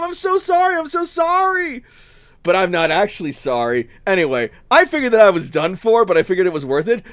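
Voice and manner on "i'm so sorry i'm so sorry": fake apology. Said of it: "And I just tell him".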